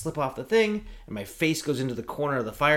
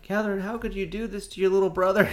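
higher, guilt-tripping whine; little brother laying guilt on his sobbing sister, mock wounded; mock reproach; 8 semitones higher than usual. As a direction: twist the knife with a hurt tone. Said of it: "but I'm like".

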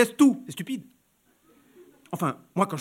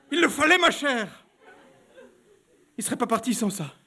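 laughing embarrassment; 8 semitones higher, 4 dB louder than usual. Acted. Baroness confessing the shameful outcome, laughing high and loud. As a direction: laugh it out, mortified.

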